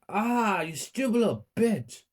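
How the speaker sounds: high-pitched screamed insult; narrated mother's high scream. A narrator's reading. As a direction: cry out high in pain and outrage. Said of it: "She started screaming".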